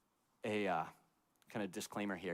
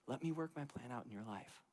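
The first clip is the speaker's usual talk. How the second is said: soft reassuring God; 2 semitones higher, 6 dB quieter than usual. Retold or imitated. God voiced, first take.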